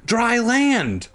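high, exclaimed castaway cry; hammed-up joyful cry; excited discovery. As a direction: call out the sighting in amazement. Castaway discovers land, comic reading.

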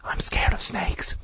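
frightened whisper; soft voice. A narrator's reading. Mike confessing his fear of snakes, in a whisper. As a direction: whisper it, barely any voice. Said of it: "Mike uttered in a soft voice".

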